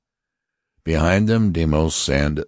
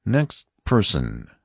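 high, stern voice of an alien officer; Martian officer; 5 semitones higher, slower than usual. Acted. Martian officer warning the passengers, stern and high. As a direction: announce it like a cold interrogator. Lighter calls next passenger.

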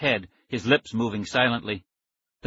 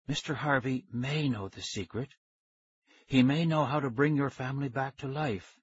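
hushed scientist; 3 semitones higher, 5 dB quieter than usual. A narrator's reading. Scientist reveals witness to ghost children, hushed. Voices the scientist Doctor Smollett.

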